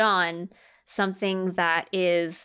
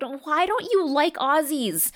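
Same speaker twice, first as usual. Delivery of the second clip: puzzled husband; 8 semitones higher, 3 dB louder than usual. Retold or imitated. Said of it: "And he's like".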